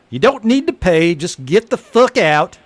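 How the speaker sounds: a bartender waving off payment and shouting him out; angry bartender. Acted. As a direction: snap at him to just leave. Bartender throws the guy out.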